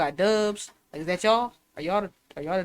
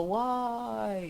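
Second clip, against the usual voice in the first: long, softly drawn-out chorus; imitated class cheer; 3 semitones higher, 6 dB quieter than usual. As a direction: mimic a class droning "why". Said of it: "it sounded like we all hated it here".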